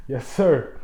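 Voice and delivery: ordinary talk, nothing marked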